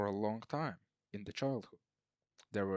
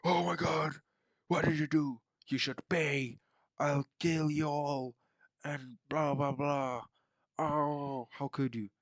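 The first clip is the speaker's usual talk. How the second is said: higher, louder hammed-up wail of grief and threats; mock outraged prince; 6 semitones higher, 7 dB louder than usual. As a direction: ham up a furious, grieving son. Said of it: "And then Wolf is like".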